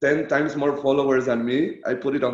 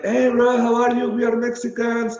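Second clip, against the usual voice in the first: Mandragora impression; 10 semitones higher, 4 dB louder than usual. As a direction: mimic an eager friend, voice pitched way up. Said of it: "You mimic him really well".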